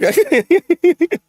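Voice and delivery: high-pitched giggle; laugh. Real, not acted. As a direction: burst into a high, brief laugh. Amused at own botched idiom.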